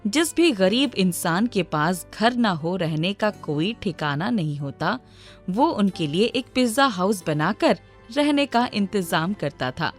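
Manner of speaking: plain reading voice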